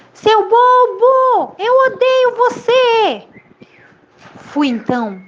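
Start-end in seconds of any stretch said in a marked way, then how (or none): 0.1-3.3 high, loud, long-drawn yell; echoed angry shout. A narrator's reading. Mountain echo returning the boy's insult, loud slow shout. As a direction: hurl the insult back as the echo. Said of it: "o menino escutou".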